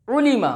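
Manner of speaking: उलीमा with short closed 'u' and 'i'; quick, pure vowels in उलीमा; maruf way. Shown as a demonstration. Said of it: "मारूफ तरीका है". (उलीमा मारूफ आवाज़ में)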